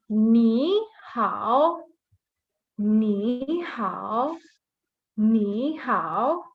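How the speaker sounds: "Ni hao" drawn out with gliding tones; toned model pronunciation. Shown as a demonstration. Teacher reading the first word for beginners, careful toned pronunciation. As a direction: stretch each syllable of "Ni hao", shaping its pitch. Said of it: "Let's read".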